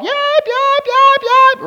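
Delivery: high, yelping yap yap of a scared little dog; yapping dog imitation. Preacher imitates the fleeing dog with high yelps. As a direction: squeak out the yaps like a fleeing small dog.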